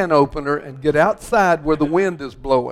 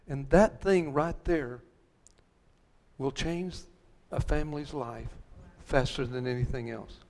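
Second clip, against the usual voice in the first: quiet; 4 semitones lower, 12 dB quieter than usual. Real, not acted. Preacher softly stressing his point to the congregation. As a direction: drop the voice to drive the point home.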